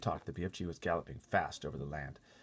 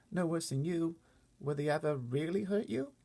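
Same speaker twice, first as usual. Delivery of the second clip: put-on higher, small girl voice; little girl Sophie; 12 semitones higher, 4 dB louder than usual. Sophie asking the giant about the other giants, small high girl voice. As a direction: speak up like a worried little girl.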